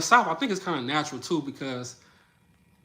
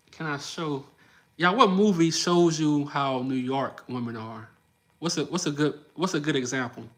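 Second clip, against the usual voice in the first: ordinary talk, nothing marked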